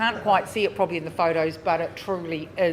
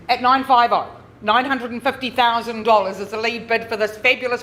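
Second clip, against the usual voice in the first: lively, raised auction chant; auctioneer calling; 3 semitones higher than usual. Real, not acted. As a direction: drive the bidding like an auctioneer. Live auction calling bids.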